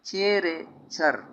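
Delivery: slow, separate syllables: छे, रे, छर; slow spelled-out demo. Shown as a demonstration. Teacher to students, building the word slowly. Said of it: "ठीक है".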